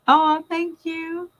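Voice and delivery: high, slow, drawn-out mom voice; mom's reaction. Retold or imitated. Mom reacting on the phone, high and slow. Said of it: "I had to call my mom".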